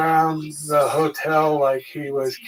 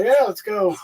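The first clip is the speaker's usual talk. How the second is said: higher, lightly pumped-up reply; re-enacted eager agreement; 6 semitones higher than usual. Fighter agreeing to go to the fight, eager re-enacted reply.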